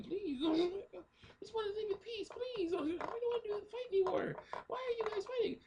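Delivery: very high squeaky falsetto plea; pleading little girl. Retold or imitated. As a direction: imitate a child begging in falsetto. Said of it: "but she was saying".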